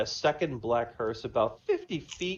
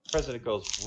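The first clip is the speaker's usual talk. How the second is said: ordinary delivery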